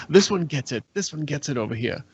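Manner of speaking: lightly voiced pointing to a laughing dwarf; single out the one who laughed; pointing out a laugher. Character points out the laughing dwarf to the crowd, lightly voiced. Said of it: "I'll point to a dwarf".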